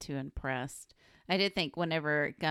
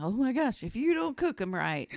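mock alarm; 7 semitones higher, 4 dB louder than usual. Co-host voicing scared gnome.

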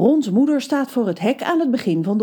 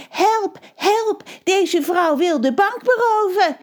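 panicked cry for help; 12 semitones higher, slower than usual. Retold or imitated. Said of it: "dus ik riep".

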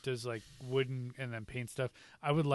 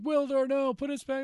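put-on pleading girl; 12 semitones higher, 5 dB louder than usual. [name] voiced pleading in retelling.

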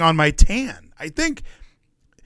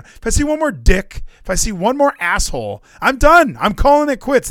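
fed-up rant; 6 semitones higher, 4 dB louder than usual. Real, not acted. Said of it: "I am almost done with this show".

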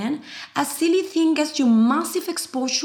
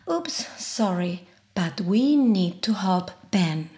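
ordinary talk

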